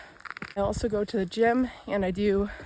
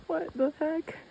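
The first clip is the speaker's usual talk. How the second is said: surprised; 8 semitones higher, 4 dB quieter than usual. Real, not acted. Surprise on the trail.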